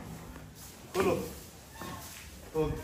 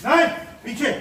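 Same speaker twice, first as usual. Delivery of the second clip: high, loud warning; sharp shout; 6 semitones higher, 5 dB louder than usual. Real, not acted. Keeper warning a lion to stay back, loud and high. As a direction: stop him in his tracks.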